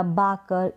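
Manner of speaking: बाकर with a long "aa" in the first syllable; wrong vowel demo. Shown as a demonstration. Teacher shows another wrong reading, बाकर with "aa". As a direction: say बाकर with "aa" for the letter A. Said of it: "इस तरीके से नहीं पढ़ेंगे".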